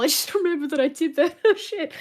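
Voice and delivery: laughing through the words; giggling while talking. Real, not acted. Laughing at own memory.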